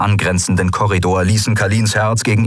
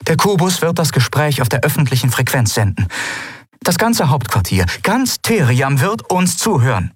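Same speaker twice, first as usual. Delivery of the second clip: higher, emphatic character voice; officer in dialogue; 8 semitones higher than usual. A narrator's reading. Officer warning that all Theriam will listen, emphatic. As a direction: announce it with measured emphasis.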